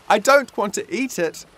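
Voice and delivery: high, loud refusal; shouting at Dad in protest. A narrator's reading. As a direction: yell it in disgust. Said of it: "shouted Mum".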